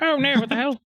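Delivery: high mock panicked cry. GM pretends how the ogre woman did not react, high mock panic. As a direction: squeal it in mock alarm. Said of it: "She wasn't like".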